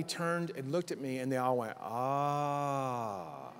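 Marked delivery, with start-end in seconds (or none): 1.8-3.5 long, sustained mock "ahh"; crowd's "ah". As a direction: draw out a group's sigh of realization. Crowd's collective reaction imitated.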